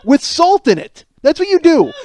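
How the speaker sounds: slow, loud, high scornful jab; scornful mockery. Comic scolding Gatorade, slow and mocking. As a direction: spell out the absurdity with contempt.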